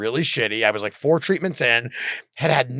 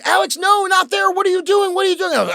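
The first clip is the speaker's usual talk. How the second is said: high, louder panicked wife voice; hammed-up alarm, pitched way up; imitating alarmed wife; 4 dB louder than usual. Wife catching husband peeing in the kitchen, high alarmed cry. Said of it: "she goes".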